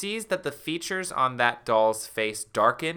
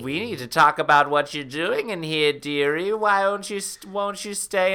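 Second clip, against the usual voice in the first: put-on sweet-sinister old woman rising higher; old crone coaxing her victim; sinister old lady; 5 semitones higher, 4 dB louder than usual. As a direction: menace wrapped in politeness. Old woman voiced menacingly.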